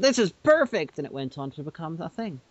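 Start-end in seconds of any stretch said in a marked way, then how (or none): 0.0-1.0 delighted customer. Retold or imitated. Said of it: "the guy went".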